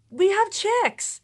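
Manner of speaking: high, drawn-out excited announcement; excited daughter. Retold or imitated. Daughter telling Mom about the chicks, excited. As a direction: announce it slowly in a high, thrilled voice. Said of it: "She's all excited".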